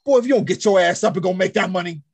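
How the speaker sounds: a no-nonsense grandma telling him to get up and work; grandma scolding. Retold or imitated. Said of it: "she'd be like".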